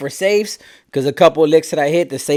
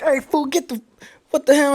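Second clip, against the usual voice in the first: higher-pitched startled retort; shocked protest; 10 semitones higher than usual. Retold or imitated. To the partner pointing a gun at him, shocked. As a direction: yelp it in disbelief at your partner.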